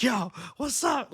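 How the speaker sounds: put-on high, slow little-girl swagger; cholilla gangster attitude. Little girl in the restroom trying bad words, gangster attitude. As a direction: imitate a tiny girl acting gangster. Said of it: "little cholilla gangster attitude".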